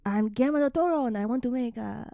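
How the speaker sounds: put-on Mexican accent, the better attempt; Guillermo del Toro. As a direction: introduce yourself in a mock Mexican director's accent. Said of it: "That, that's better".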